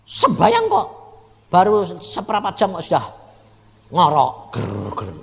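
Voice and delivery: loud, high scolding; scolding wife. Retold or imitated. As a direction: tell her off sharply, half-mocking. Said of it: "Sampai pernah saya marahin". (omelan keras bernada tinggi)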